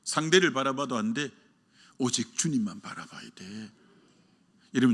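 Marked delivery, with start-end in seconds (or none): 2.0-3.7 breathy hushed whisper at the climax. Acted. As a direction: lean in and whisper the key line. (속삭이듯 오직 주님만 바라봐야 돼)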